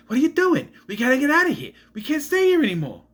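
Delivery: put-on high, urgent over-the-top Boston accent; Mark Wahlberg. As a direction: do an exaggerated, frantic Boston tough guy. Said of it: "sound like Mark Wahlberg".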